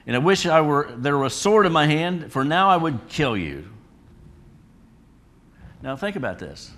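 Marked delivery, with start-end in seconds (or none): 0.0-3.7 loud, higher enraged threat that slows at the end; loud acted rage at the donkey; furious Balaam. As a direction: bellow it like a man who wants a sword. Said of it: "And Balaam said to the donkey".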